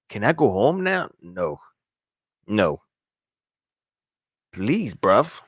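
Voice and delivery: mock pleading English accent. Host pleads as the broke player wanting home, mock English accent. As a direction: put on a playful English accent, begging. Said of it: "That's my best English accent".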